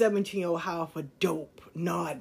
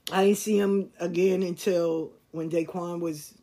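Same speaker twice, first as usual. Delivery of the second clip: normal talking voice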